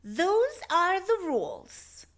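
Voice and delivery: stern librarian. A narrator's reading. Librarian telling the lion the rules, stern and slow. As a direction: spell out the rule slowly, no arguing. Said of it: "she said in a stern voice".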